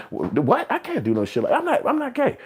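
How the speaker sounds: stammered protest climbing to a squeak; mock-flustered, high-pitched denial; startled refusal. Acted startled refusal under peer pressure.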